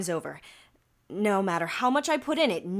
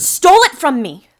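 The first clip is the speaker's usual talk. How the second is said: much louder, higher outburst; furious shout; 5 semitones higher, 15 dB louder than usual. Acted. Miss Miller shouting over the detective's doubt, enraged. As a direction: yell the accusation at full force. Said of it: "calm down".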